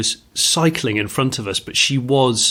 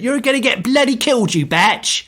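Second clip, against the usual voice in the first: much higher imitation of him shouting back; yelled warning; 11 semitones higher than usual. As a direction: yell it over your shoulder as you pass. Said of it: "he turned around and went".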